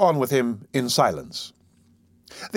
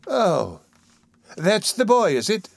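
lightly voiced undertaker; 7 semitones higher, slower than usual. A narrator's reading.

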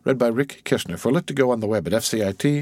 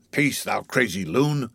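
ordinary delivery

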